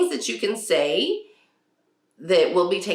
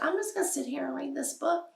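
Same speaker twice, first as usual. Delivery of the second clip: put-on higher, softer bookish boy; dreamy son; 9 semitones higher, 8 dB quieter than usual. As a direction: content little reader tucked away. Son Samuel voiced by mom.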